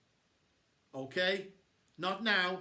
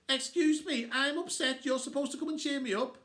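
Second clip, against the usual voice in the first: put-on much higher and louder girl's demand; indignant yell; 11 semitones higher, 5 dB louder than usual. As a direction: yell it like a sulky teen. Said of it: "she yelled at me".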